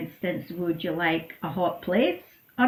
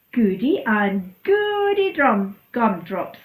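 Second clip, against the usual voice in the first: slow, loud delighted cheer; overjoyed; 2 semitones higher, 5 dB louder, slower than usual. Acted. Goat cheering to Donkey, slow and delighted. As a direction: savour every word of the joy.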